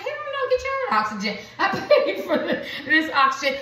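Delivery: high, fast, snippy mock voice with a laugh; selfish refusal. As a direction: play the greedy one hogging the oxygen, high and quick. Mock selfish reply to offer.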